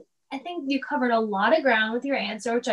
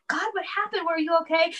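worried husband; 5 semitones higher, faster than usual. Retold or imitated. Husband worried, sobbing wife.

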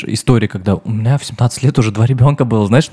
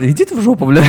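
шуточный писк; 7 semitones higher than usual. Pretend. Mock retort to teasing.

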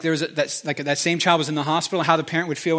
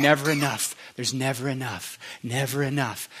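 soft, taunting murmur, softer; mock whisper; 4 semitones lower, 5 dB quieter than usual. Demons whispering lies in their ears, soft taunting murmur. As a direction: murmur it like a voice in the ear. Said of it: "whispering in your ears saying".

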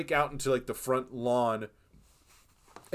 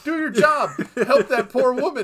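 much higher and louder, yelling at the screen; exasperated shout; 10 semitones higher, 10 dB louder than usual. Retold or imitated. Viewer yelling at the cop to help the woman, exasperated shout. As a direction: shout at him to do his job.

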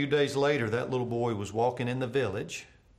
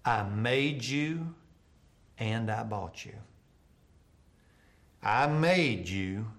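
slow tender vow; 3 semitones lower, slower than usual. A narrator's reading. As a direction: savour every word like a promise. Boy hugs his regained kite.

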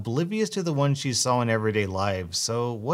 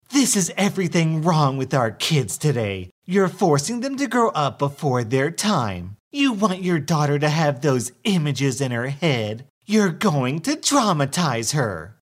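higher, louder self-righteous rant; ranting entitled mother; 3 semitones higher, 4 dB louder than usual. Acted. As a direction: lecture like a moral crusader. Entitled mother rants at narrator.

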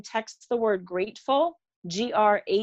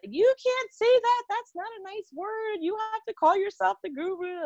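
mock scolding; 12 semitones higher than usual. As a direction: squeak out a disapproving objection like a fussy critic. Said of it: "People were like".

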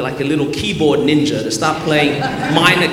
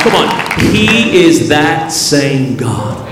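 loud, driving sermon climax; forceful preacher building a rousing list; preaching at full force; 3 semitones higher, 8 dB louder than usual. Real, not acted. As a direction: belt out each phrase to rouse the congregation.

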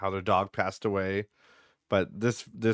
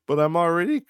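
put-on higher, whiny kid protest; imitating upset son; 9 semitones higher, 7 dB louder than usual. Son protesting to his mom, imitated whiny. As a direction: plead as the hurt kid who's already crying. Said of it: "he's like".

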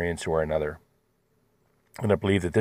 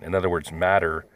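ordinary talk

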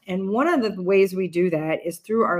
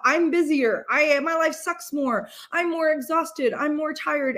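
higher, slower whiny one-upping; mock competitive complaining; 7 semitones higher than usual. Mimicking people who compete over who is busier, higher and whiny. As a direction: whine that your life is worse. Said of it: "we have this culture about".

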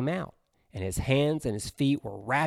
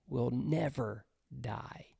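whisper; 10 dB quieter, slower than usual. Acted. Whispered climax of verse.

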